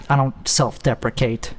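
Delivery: ordinary talk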